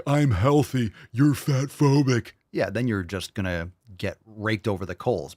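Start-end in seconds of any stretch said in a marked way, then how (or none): 0.0-2.3 higher, hammed-up mock complainer; slow down mockingly on "fat phobic"; mocking whiny imitation. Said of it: "when that same person's like".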